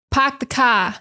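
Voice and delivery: "park the car" as "pahk the cah"; Boston accent. Shown as a demonstration. Teacher showing students the classic Boston phrase. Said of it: "Boston is out here saying".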